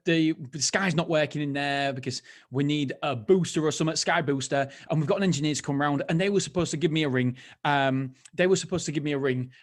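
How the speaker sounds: normal talking voice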